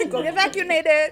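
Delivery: loud high laughing echo; giggly mock repeat; laughing mimic. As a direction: tease her by repeating it. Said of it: "the tone in which it was delivered".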